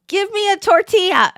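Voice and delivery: put-on high, loud demanding toddler voice; mock child ordering food loudly; bossy little kid. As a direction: bark it like a pushy four-year-old. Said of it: "he just never talks like that".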